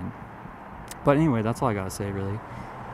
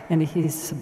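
normal talking voice

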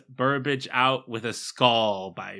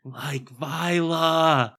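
excited realisation; 4 semitones higher, much slower than usual. Real, not acted. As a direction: call it out delighted.

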